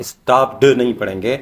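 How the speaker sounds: ordinary talk, nothing marked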